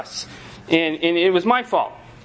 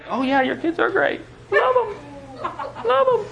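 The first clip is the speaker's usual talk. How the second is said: gushing, much higher and laughing; fake gushing praise; 11 semitones higher, slower than usual. Friend flattering a parent, fake high gushing. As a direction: overdo the polite praise, sky-high. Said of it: "act like".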